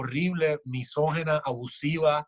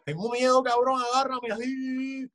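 mock fear; 7 semitones higher than usual. Voicing scared humanity in quote.

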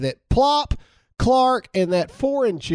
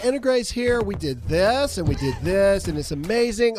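high, bubbly YouTuber intro; kid YouTuber voice; 6 semitones higher than usual. Retold or imitated. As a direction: imitate a kid vlogger signing on. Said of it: "she's like".

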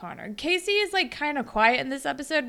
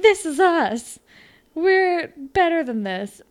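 higher and louder, slow and heartfelt; earnest Declan; 7 semitones higher, 7 dB louder, slower than usual. Retold or imitated. Declan reassuring Holly J her past doesn't matter, earnest. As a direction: reassure her with hammed-up sincerity.

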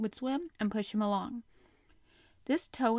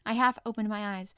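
ordinary delivery, nothing marked

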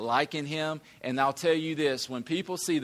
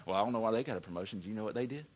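very fast, quieter snide reply; jealous suspicion; 5 semitones lower, 6 dB quieter, much faster than usual. Retold or imitated. Preacher mimics a churchgoer doubting a coworker's promotion, fast and snide. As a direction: rattle it off, suspicious and petty.

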